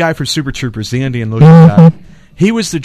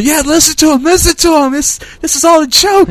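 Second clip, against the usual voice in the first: pitched way up, frantic pleading; mock yelling; 11 semitones higher than usual. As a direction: imitate a panicked man begging from a car trunk. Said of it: "yelling at him".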